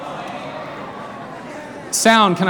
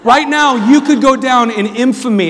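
hype announcer; 6 semitones higher than usual. Real, not acted. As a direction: pump up the crowd like a game show host. Host hypes contestant to crowd.

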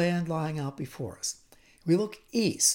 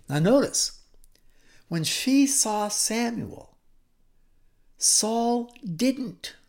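usual speaking voice

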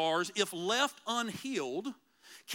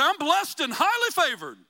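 higher, louder, over-bright stock answer; mock churchy cliché; 5 semitones higher, 8 dB louder than usual. Pastor mocks what Christians are expected to answer, hammed-up cliché. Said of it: "I gotta say".

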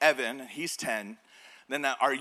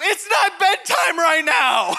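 high, loud, drawn-out mock wail; exasperated panicked child; 11 semitones higher, 8 dB louder, slower than usual. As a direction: yell back like a kid in panic. Panicking son yells back.

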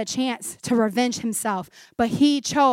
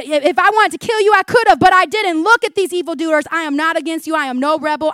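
louder, higher plea of innocence; insistent protest; 5 semitones higher, 8 dB louder than usual. Acted. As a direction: press each point forcefully. Acts David protesting his innocence.

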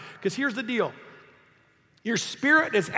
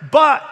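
"But" hit much higher and far louder; stress on But; 7 semitones higher, 13 dB louder than usual. Shown as a demonstration. Preacher stressing the turn in the verse, loud "But". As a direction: punch the word "But" hard. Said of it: "you need to know where that "but" is".

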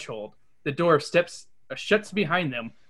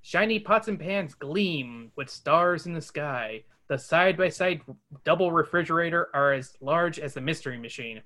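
normal talking voice

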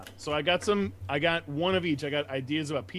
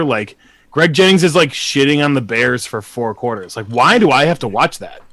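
loud rant; 13 dB louder than usual. Real, not acted. Ranting about boring commentators.

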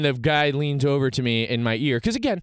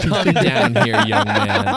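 loud mock elder's command; stern summons; 3 semitones higher, 7 dB louder, slower than usual. Older gentleman waving a young man down, loud and commanding. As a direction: order the youngster over, loudly. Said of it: "It was like a".

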